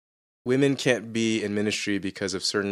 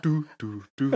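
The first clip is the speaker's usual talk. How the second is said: Homer Simpson doo; 6 semitones higher than usual. Retold or imitated. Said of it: "Homer Simpson beer jug where he's like".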